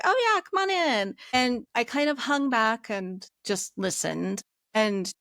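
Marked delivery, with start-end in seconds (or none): 0.0-1.1 high, bright-toned classmates' welcome; much higher eager classmate voice; cheery welcome. Retold or imitated. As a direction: sing out a friendly come on in. Classmates welcoming Sue into group.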